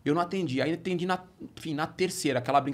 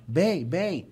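put-on old-fashioned "bem" call; 2 semitones higher than usual. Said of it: "os antigos chamam de bem".

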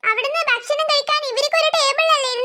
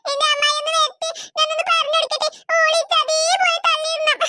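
acted bawling between phrases; wailing complaint. Hurt child telling on the one who pushed her, wailing. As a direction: sob out an aggrieved complaint.

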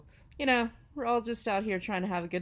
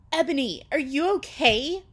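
higher, louder worried question; mock concerned voice; 7 semitones higher, 10 dB louder than usual. A narrator's reading. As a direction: ask with hammed-up concern. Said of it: "asked in a concerted voice".

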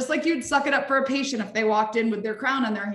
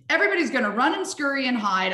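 normal talking voice

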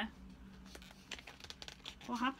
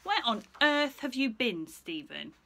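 cross mother; 3 semitones higher, 8 dB louder, slower than usual. Acted. Mother scolds Stephen for being so late, loud and cross. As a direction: tell him off, counting items slowly.

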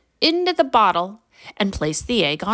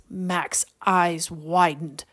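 ordinary delivery, nothing marked

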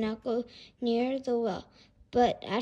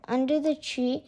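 usual speaking voice

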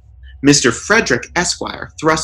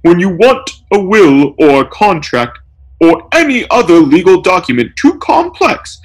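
tearful; 8 semitones higher, 4 dB louder than usual. A narrator's reading. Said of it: "tearfully interrupts".

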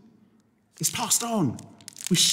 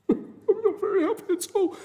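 much higher, put-on hurt little voice; mock sad voice; 14 semitones higher than usual. The mean person's victim, hurt and much higher. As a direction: whimper it like a hurt child. How would they feel?.

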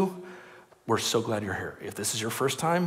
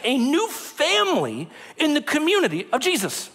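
full-force preaching; 11 semitones higher, 4 dB louder than usual. Acted.

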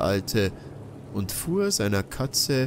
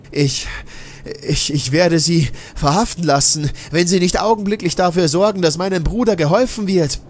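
rising threat; 8 semitones higher, 9 dB louder, faster than usual. Acted. Narrator threatens old man.

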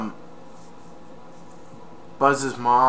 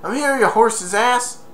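put-on taunting kid; 8 semitones higher than usual. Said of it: "he said".

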